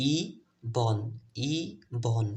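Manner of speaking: "ই", pause, "বন", no eh in the ending; syllable by syllable. Shown as a demonstration. Teacher drills the correct form, "ই বন" broken into two syllables. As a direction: sound out "ই" and "বন" separately, twice. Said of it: "শুদ্ধ উচ্চারণ হচ্ছে ইবন".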